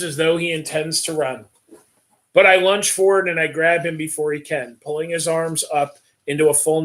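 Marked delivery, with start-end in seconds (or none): none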